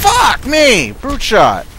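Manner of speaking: loud startled yell; shouting. Real, not acted. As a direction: scream it in panic. Yelling while under fire.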